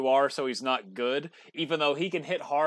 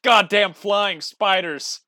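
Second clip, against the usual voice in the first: loud, higher, exasperated complaint; mock outraged rant; 7 semitones higher, 9 dB louder than usual. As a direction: ham up the outrage about the bugs. Ranting about Oklahoma spiders.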